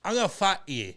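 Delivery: mock New York street accent. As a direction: say it like a Bronx tough guy. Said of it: "that's how they say it in".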